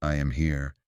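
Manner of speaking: quietly. Shown as a demonstration. Teacher showing learners a quiet self-affirmation, softly spoken. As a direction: say it gently under your breath. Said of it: "say this quietly".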